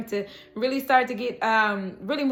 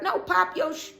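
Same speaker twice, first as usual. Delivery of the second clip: higher playful scold; 6 semitones higher than usual. Real, not acted. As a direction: tease them to show off.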